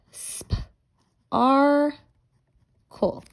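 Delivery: "Sp, ar, cool" in three pieces, loud high held ar; whispery sp, big stretched AR, short cool; sounding out sparkle for spelling, "Sp... arrr... cool"; segmented sounds. Shown as a demonstration. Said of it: "let's write our word".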